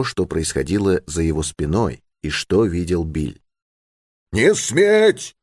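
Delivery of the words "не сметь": high, forceful cry to stop; rough commanding shout. A narrator's reading. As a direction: forbid it with a sudden high shout. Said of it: "крикнул громко чей-то грубый голос". (грубый властный окрик)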